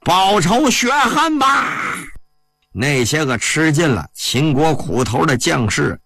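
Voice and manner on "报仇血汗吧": high, drawn-out rallying cry; battle command. A narrator's reading. A general orders his troops to take revenge, high drawn-out command. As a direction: call the order out high, stretching each word. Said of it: "随后传令".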